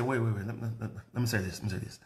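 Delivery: soft, hushed, calming them down; softly settling an upset caller. Retold or imitated. Pastor hushing angry callers, soft and calming.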